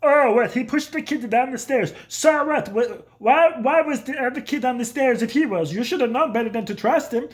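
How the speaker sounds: dad brushing off the principal's complaint; dad defending son. Retold or imitated. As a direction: play the outraged father at the principal. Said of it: "he'd go over there and be like".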